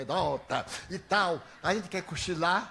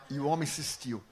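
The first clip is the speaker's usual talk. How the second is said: usual speaking voice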